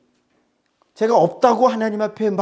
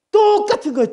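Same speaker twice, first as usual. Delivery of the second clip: exclaimed; 13 semitones higher than usual. Real, not acted. Preacher stresses the point.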